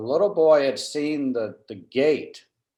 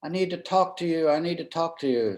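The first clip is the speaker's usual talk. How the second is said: imitating a higher-pitched plea called out across the room; woman calling from the back; 6 semitones higher than usual. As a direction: call out Judge Barnes like a desperate mother at the back. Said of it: "I hear this woman".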